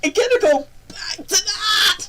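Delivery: loud, high fan scream; excited shouting. Real, not acted. Streamer discovering Captain Foxy's Cowboy Adventure, ecstatic shouting. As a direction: shriek it out, beside yourself with excitement. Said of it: "how excited I am for that".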